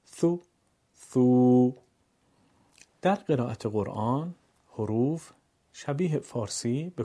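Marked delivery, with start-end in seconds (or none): 1.0-1.7 ثو held long and louder; long u, two beats. Shown as a demonstration. Teacher demonstrates long ثو for pupils. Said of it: "دو برابر بکشیم".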